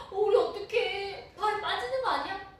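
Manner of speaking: high, loud and slow with a laughing, crying quaver; frightened disciples. Acted. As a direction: panic over sinking, half-laughing. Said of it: "두려워하고 있었던 그 상태였어요".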